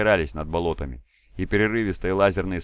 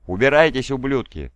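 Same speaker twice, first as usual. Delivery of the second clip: higher, louder furious roar; angry yell; 5 semitones higher, 6 dB louder than usual. A narrator's reading. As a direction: bellow curses at the enemy. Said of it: "заорал на них Люк Сидыры".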